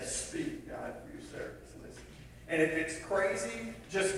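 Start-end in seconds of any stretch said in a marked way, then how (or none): 0.0-2.0 soft whispered, hurried plea to God; a hushed prayer of surrender. Shown as a demonstration. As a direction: breathe it like a private prayer. Simple prayer of listening.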